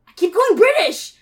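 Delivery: loud, high burst of frustration; exasperated outburst. Real, not acted. Player breaking character over the slipping accent, loud exasperation. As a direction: blurt it out, exasperated.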